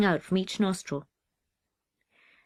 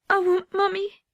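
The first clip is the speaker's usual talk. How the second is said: homesick whimper; 12 semitones higher, 6 dB louder than usual. Acted. Homesick small boy in the dark.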